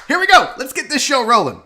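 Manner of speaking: high, loud show-opening call; excited kickoff. Real, not acted. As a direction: launch the show with a burst of energy. Kicking off the show.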